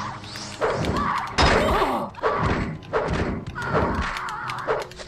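high maniacal cackle; evil cackle. Acted. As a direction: cackle like a gleeful villain. Villainous laugh at enemies.

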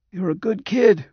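high, reedy thanks, not fully whispered; Roger. A narrator's reading. Patient thanks nurse for flowers, high reedy near-whisper. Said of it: "whispered Roger".

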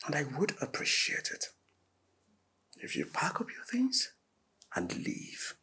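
very soft and low, nearly whispered; hushed, controlled request to leave; cold, quiet dismissal. Acted. Husband asking wife to pack and leave, cold and hushed.

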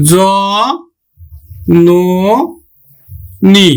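জ, ন, নি said apart, ন as নো; syllable by syllable. Shown as a demonstration. Teacher showing the syllables to students, one at a time. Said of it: "আমরা উচ্চারণ করব কিন্তু".

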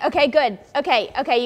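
normal talking voice

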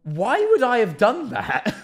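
slow, high-pitched incredulous question; retold bewildered protest; shocked. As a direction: stretch it out in squeaky disbelief. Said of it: "I literally was so shocked".

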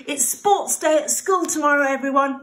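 Mummy Pig calling out the big news; cheery announcement. Acted. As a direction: call the family like an excited mum.

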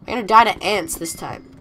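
ordinary talk, nothing marked

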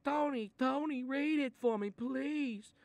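mock the kid begging to be read to; high whiny kid begging Tony. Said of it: "and you say".

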